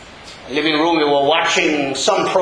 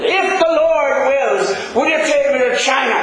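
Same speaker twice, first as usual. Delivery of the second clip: lightly voiced child's question, higher; granddaughter quoted; 11 semitones higher than usual. Preacher repeating his granddaughter's question, mock childlike. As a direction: echo the little girl's question with delight. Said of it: "We're talking about a six-year-old".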